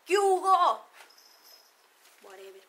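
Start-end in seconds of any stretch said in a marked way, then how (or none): 0.1-0.9 put-on loud, high old-school hello; older man's shouted greeting. Dad's generation greeting a friend with a high shout. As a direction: bellow it like dad greeting a buddy. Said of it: "mi papá y todas esas generaciones".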